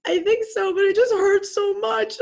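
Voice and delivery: retold sobbing reply; crying reply. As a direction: answer through tears, laughing and crying at once. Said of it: "And I said".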